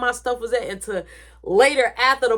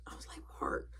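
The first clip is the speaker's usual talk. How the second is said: hushed unvoiced whisper; 19 dB quieter than usual. Real, not acted. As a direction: share it like a secret. Secret told to Mark.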